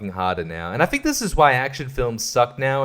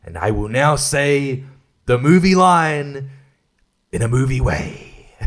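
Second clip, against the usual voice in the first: slow, hammed-up declaiming; showy movie delivery; 3 dB louder, slower than usual. Actor delivering the movie line, showy and stagy. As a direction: announce each phrase grandly, stretching the ends. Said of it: "being very showy and movie-like".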